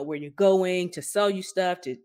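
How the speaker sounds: ordinary talk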